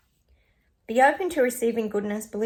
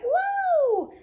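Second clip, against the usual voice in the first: excited woo; 4 dB louder than usual. Real, not acted. Celebrating good energy.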